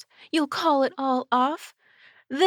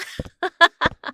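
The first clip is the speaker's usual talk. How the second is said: high, louder parting cackle; taunting cackle; 8 semitones higher, 5 dB louder than usual. Acted. Captor cackles goodbye to the vampire.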